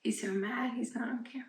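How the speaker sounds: whispered aside; softly whispered aside. Real, not acted. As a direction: drop to a whisper about the cat. Aside about cat.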